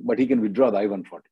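ordinary delivery

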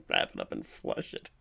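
laughing too hard to voice the words; softly gasped out mid-laugh; breathless laughter. Real, not acted. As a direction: wheeze it out through laughter, voiceless. Repeating the joke while laughing.

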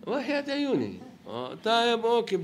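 high mocking sweet talk; sarcastic yes, voice jumping high. Sarcastic reply to forced dialogue.

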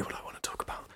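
fast eager whisper. Real, not acted. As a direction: whisper it like a guilty secret. Confiding aside about trashy books.

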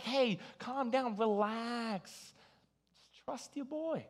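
soft, slow, smug hush; annoying calm-down. Shown as a demonstration. Preacher to worried friends, softly smug reassurance. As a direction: soothe them in a teasing way. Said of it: "I wanna be really annoying about it".

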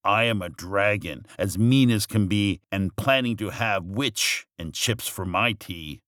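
ordinary delivery

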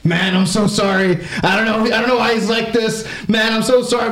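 high, pleading apology, last line shouted; apologetic guy. Retold or imitated.

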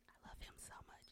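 soft whisper to herself. Real, not acted. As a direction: whisper it lovingly. Whispered aside about her cat.

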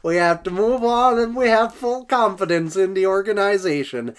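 high, loud hammed-up Swedish accent drifting between accents; mock Swedish accent. Host mocking Klingberg's quote in a hammed-up Swedish accent. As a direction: play a Swedish hockey player giving a statement, laughing through it. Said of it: "Swedish to Italian, back to Swedish to, like, Icelandic to Minnesotan".

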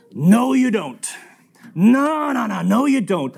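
high, loud contradiction; emphatic denial. Retold or imitated. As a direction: imitate a man cutting in to disbelieve. Harry flatly contradicts him.

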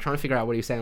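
normal talking voice

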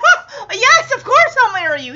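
overjoyed acceptance, laughing; excited yes. Retold or imitated. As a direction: blurt it out thrilled and fast. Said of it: "He's so excited".

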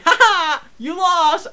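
high, loud, drawn-out mock crowing; gloating taunt. Kid taunting a beaten laser tag player, gloating shout. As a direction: crow over your victim like a kid.

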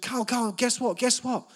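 high, loud, bubbling with news; imitating mum bursting with excitement; excited mum. Mum to teen son, bursting with news, high and excited. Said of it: "And she went".

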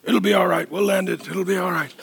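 low, soft, soothing reassurance; calm co-pilot murmuring comfort; reassuring midwife. Acted. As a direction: talk them down calmly, voice dropped. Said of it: "The midwife. And she's like".

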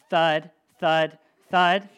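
loud, heavy thud sounds; mock thudding steps; heavy footsteps. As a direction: stomp it out like giant steps. Giant's footsteps sound effect.

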